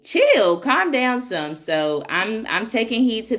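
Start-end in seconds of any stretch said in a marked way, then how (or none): 0.0-1.5 high-pitched mock telling-off, punched at the start; playful acted "settle down" from a husband; imitating husband. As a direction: quote your husband telling you to chill, pitched well up. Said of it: "he's like".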